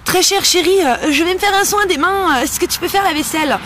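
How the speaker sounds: high, honeyed mock plea to the husband; sweet-talking wife. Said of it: "on va pas dire à notre mari". (épouse qui amadoue son mari d'une voix mielleuse et aiguë)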